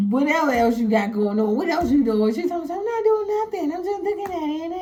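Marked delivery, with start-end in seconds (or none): 2.8-4.7 high, lightly sung fake innocence; a hint of a caught wife playing dumb; innocent singsong. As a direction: play it innocent with a sing-song lilt.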